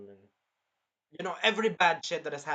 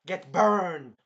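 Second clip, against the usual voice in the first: loud, higher battle yell; attack shout; 4 semitones higher, 9 dB louder than usual. Retold or imitated. Hero burns the dragon, loud taunting shout. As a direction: hurl it as a finishing blow.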